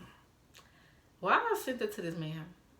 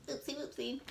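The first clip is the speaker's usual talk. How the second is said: cutesy oopsie; 7 semitones higher, 7 dB quieter than usual. Retold or imitated. Playing off the mistake.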